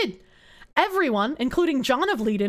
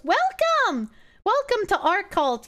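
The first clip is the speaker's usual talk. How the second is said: mock cult welcome; 7 semitones higher, slower than usual. Greeting chat into cult.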